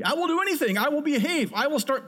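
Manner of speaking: high, overeager vows to God; mock promising. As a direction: ham up a hollow promise. Mocking empty promises to God.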